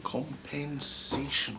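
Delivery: slow, soft, falling repeat of Compensation; slow musing. Acted. Boss slowly pondering the word compensation, soft and slow. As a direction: drag the word Compensation out, weighing it.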